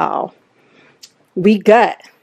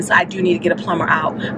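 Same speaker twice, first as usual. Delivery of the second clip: ordinary talk, nothing marked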